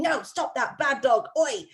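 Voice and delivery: half-hearted yell at a dog; mock scolding yell. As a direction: play at scolding a naughty dog. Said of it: "instead of yelling".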